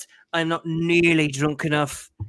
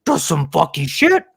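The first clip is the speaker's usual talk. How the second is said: Bubbles voice; 7 dB louder than usual. Retold or imitated. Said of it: "as Bubbles from Trailer Park Boys would put it".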